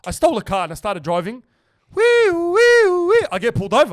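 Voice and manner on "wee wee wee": high wailing wee-wee siren noise; imitated police siren. Teller mimicking the siren when the police chase him, high wailing siren. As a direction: mimic a cop siren as you speed off.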